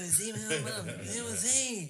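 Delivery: [name] mumbling nonsense; mumbled nonsense, rising on the last. Pretend. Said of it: "Bob's agreeing with me".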